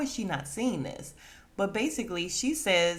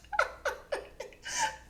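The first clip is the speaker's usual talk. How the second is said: high, airless squealing laugh; breathless laugh; 5 dB quieter than usual. Real, not acted. Host dying of laughter at G, airless squeal. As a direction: laugh until no voice is left.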